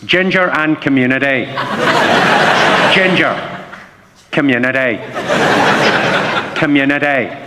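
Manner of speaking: Northern Irish accent with attitude. Shown as a demonstration. Comedian showing the accent is just an attitude, Northern Irish. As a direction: give each word Northern Irish attitude. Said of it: "like Northern Ireland I can do".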